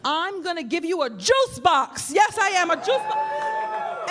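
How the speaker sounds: slow build to a high squealed juice box; doting mommy rising to a high squeal; mock mommy squeal.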